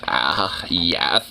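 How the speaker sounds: low, lightly put-on airhead voice; lightly voiced valley girl. Mocking women who want to be housewives, low mock valley-girl voice. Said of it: "valley girl, like, you know, just dumb, headed voice".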